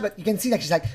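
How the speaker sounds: ordinary talk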